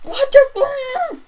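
loud, high-pitched disbelief; high startled yelp; startled outburst. Real, not acted. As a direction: shoot up high and loud in shock.

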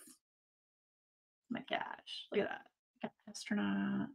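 breathy hushed oh my gosh, drawn out slow; awed whisper. Real, not acted. Unboxing galaxy stickers for chat, awed whisper. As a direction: whisper it in awe.

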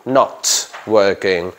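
NOT drawn out slowly and hit hard; stress on not. Shown as a demonstration. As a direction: lean long on not. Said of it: "not, not, not". (acento en NOT, lento)

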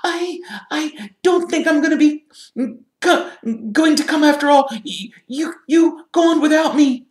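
very high, loud squeaky voice; a high-pitched, halting refusal, urging others onward; distressed Hermione. A narrator's reading. Hermione telling her friends to go without her, distressed high voice.

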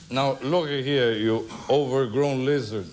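put-on slow, deliberate drunken warning; mock-stern drunk telling off a heckler; indignant drunk. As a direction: draw it out with wounded dignity. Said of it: "this guy is drunk".

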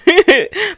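high, loud burst of laughter; laughing at herself after the steps. Real, not acted. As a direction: laugh it off, bright and sudden. Laughs at own exhausted remark.

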